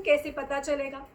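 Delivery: usual speaking voice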